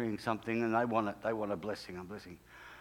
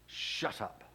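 low, hushed order to be silent; hushed command; 3 semitones lower, 6 dB quieter than usual. Retold or imitated. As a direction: quietly cut off someone's chatter. God silences the praying pastor.

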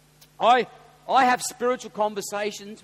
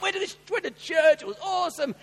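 shouted, much higher gushing; excited; 11 semitones higher, 7 dB louder than usual. Shown as a demonstration. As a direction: burst with excitement about church. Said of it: "That's how we need to talk".

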